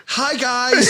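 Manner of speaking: high, dorky imitation of a greeting; mock cheery greeting. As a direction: chirp it high, mocking the dorky arrival. Mocking their dorky arrival in Mustang.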